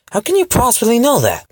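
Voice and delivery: high, loud disbelief; stunned he knows her purse is light; sharp shocked question; incredulous. Acted. Heroine caught out by the thief.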